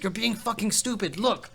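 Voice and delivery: put-on high, loud German-accented scolding; mock German accent, exasperated. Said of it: "your German accent".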